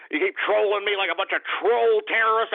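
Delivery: ordinary talk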